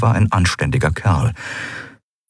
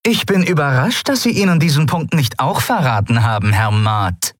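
acid sarcasm, "Herr Maat" dripping with mock respect; biting sarcasm; 8 semitones higher than usual. A narrator's reading. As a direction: cut him down with sarcasm. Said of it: "Der Sarkasmus in Zaylars Stimme war ätzend wie Säure".

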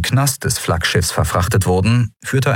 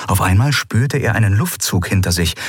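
plain reading voice

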